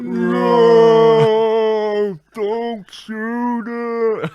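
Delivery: long drawn-out mock slow-mo cry; slow-motion yell. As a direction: stretch every word like a slowed-down film, high and loud. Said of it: "playing out in slow motion".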